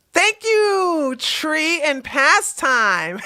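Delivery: high, drawn-out singsong thanks; mock anchor reply. Live host thanking his past self, hammed-up announcer-style. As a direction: stretch the words like a hammed-up anchor.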